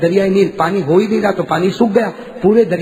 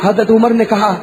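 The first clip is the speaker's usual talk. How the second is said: usual speaking voice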